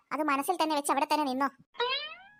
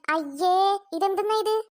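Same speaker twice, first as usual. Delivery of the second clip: loud, higher yuck; louder disgusted cry; 4 semitones higher, 4 dB louder, slower than usual. Acted. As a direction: spit out the taste. Tasting bad payasam.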